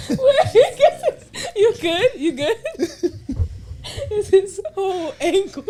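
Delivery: words squeezed out through loud high laughter; can barely talk for laughing; laughing hard. Real, not acted. Friend's ankle mishap, cracking up.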